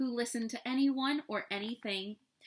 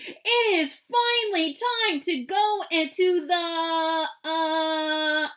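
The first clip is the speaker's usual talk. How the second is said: slow, loud, raised Papa Bear boom; 7 semitones higher, 8 dB louder, slower than usual. A narrator's reading. Papa Bear announcing the outing, slow loud booming voice. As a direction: boom it out, dragging the last words. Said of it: "booming voice".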